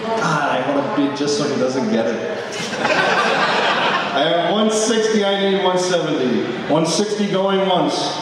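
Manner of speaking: steady auction bid calling; auctioneer. Real, not acted. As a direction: call bids like an auctioneer.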